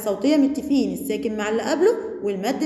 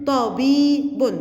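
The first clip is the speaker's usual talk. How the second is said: slow blended word; much slower than usual. Shown as a demonstration. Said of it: "طاء باء ممدودة بالياء باء".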